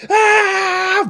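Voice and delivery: high, loud mock cheer; long high-pitched "aah" of a wild crowd; imitated crowd scream.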